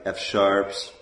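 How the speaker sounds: ordinary talk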